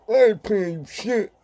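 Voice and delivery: high, slurred deaf-speech impression; imitating a deaf friend's speech. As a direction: voice him high and thick like he can't hear himself. Deaf friend voiced in prison story.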